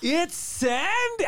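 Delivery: high, long-drawn name announcement; strip club DJ. Pretend. Mock DJ announces dancer's name.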